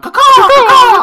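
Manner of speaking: very high, loud laughing caws; crowd crow cawing. Retold or imitated. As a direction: shriek like a stadium of crows. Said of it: "everybody there's going".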